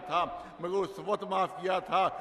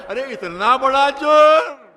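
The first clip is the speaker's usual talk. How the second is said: loud, slow, shocked cry of disbelief; stunned brother crying out; shocked exclamation; 6 semitones higher, 13 dB louder, slower than usual. Acted. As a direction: let the brother gasp it out, appalled. Brother shocked at Hurr's plan.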